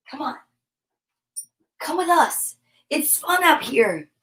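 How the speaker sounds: ordinary talk, nothing marked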